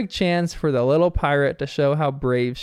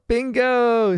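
mock yell; 7 semitones higher than usual. Bluey calling to Bingo as she nearly falls, mock yell. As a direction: call out her sister's name in alarm. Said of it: "Bluey yells out".

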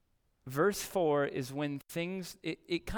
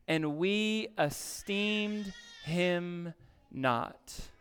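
solemn scripture reading; 3 semitones higher, much slower than usual. Acted.

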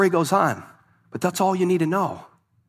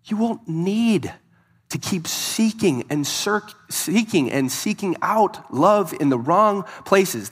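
slow emphasis; 2 semitones higher, slower than usual. Acted. Slowed, emphatic preaching.

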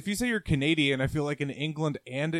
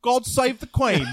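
put-on British accent; 9 semitones higher, 8 dB louder than usual. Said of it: "if you go to London".

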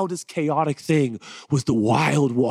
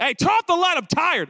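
mock rude spouse.

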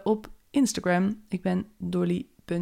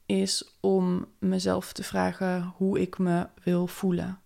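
calm, unhurried meditation voice; guided meditation. Acted. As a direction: guide them gently into the day. Leads a morning meditation. (rustige meditatiestem)

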